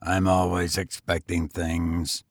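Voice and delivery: lightly voiced weary tramp. A narrator's reading.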